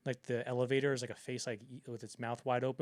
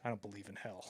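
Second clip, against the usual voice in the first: deadpan Kubrick; 5 semitones lower, 11 dB quieter than usual. Retold or imitated. Kubrick's deadpan reply to King.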